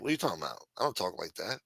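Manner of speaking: ordinary talk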